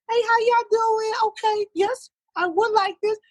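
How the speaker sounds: very high put-on girly voice; young female. Manager calls the bar in disguise, young female voice. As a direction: squeak it out like a young woman caller. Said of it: "I'll do the young female".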